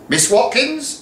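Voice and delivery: high, formal announcement; teacher calling her own name; stern deputy addressing herself. Acted. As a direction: summon herself to account. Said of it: "announced Miss Watkins".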